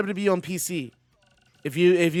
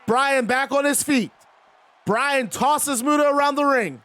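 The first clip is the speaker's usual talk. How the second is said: excited commentary shout; 7 semitones higher than usual. Real, not acted. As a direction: call the move like a hyped commentator. Hype commentary on a big move.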